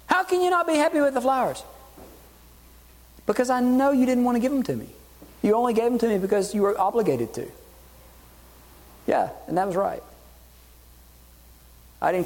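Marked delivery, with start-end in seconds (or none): 0.0-1.6 high mock-indignant husband. Husband protesting to his wife on their anniversary, high indignant whine. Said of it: "And I go". 3.2-7.5 high, quick voice of a hurt wife. Retold or imitated. Wife telling husband the flowers were obligatory, high hurt accusation.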